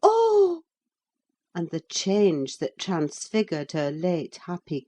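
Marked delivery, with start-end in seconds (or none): 0.0-0.6 high despairing "Oh!"; a sharp cry of despair; anguished cry. A narrator's reading. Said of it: "her single "Oh!" ... anguished cries".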